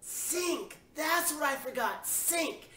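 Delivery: high-pitched cry of realizing the mistake; sudden realization. Acted. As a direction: exclaim it high, as it dawns on you. Sudden realization after being scolded.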